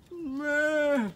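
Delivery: high bleating meh like a goat. Retold or imitated. Answering the goat, high mock bleat.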